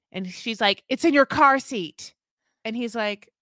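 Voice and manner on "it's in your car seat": retold snapping back. Retold argument between the couple.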